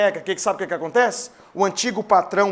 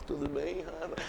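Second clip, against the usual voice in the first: much softer, lower greeting; 7 semitones lower, 16 dB quieter than usual. Retold or imitated. Franco gently greeting Rafa, much softer. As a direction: greet gently under the breath.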